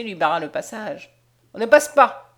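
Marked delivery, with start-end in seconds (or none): 1.5-2.3 loud, rude refusal; rough soldier's refusal, punched out loud; rude, gruff order. A narrator's reading. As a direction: bar the way with a loud, curt command. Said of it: "d'une voix rude".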